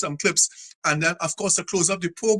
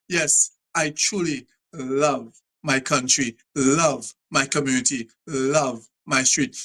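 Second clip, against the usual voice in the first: slow, lower, heartfelt; slow, lower, sincere pledge; heartfelt declaration; 4 semitones lower, slower than usual. Real, not acted. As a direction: say it slowly from the heart.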